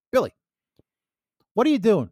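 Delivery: retold exasperated call to a kid brother. Interrupting kid brother a second time.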